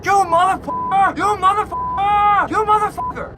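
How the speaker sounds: high, loud road-rage screaming; enraged yelling. Retold or imitated. Enraged driver yelling across from the next car, mock screaming. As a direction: imitate a driver screaming curses.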